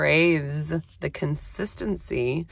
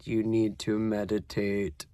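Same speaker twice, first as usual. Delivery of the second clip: inner voice; 6 semitones lower, 7 dB quieter than usual. Retold or imitated. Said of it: "this voice comes into my head".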